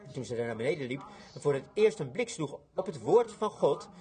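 usual speaking voice

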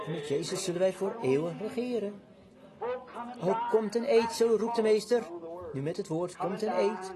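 soft, tender preaching under the breath; hushed reverence. Real, not acted. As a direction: draw the congregation close in awe. Soft, reverent passage about the bride.

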